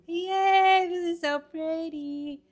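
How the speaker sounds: squealing with delight at the finished border; overjoyed. Real, not acted. Puzzler thrilled at finishing the border, squealing happily. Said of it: "I'm so happy".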